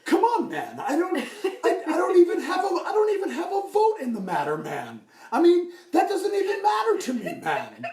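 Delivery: high, loud, pleading protest that drops on "man"; Tom Henry. Acted. Tom insisting he has no vote, high exasperated voice. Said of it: "working on my Tom Henry impression".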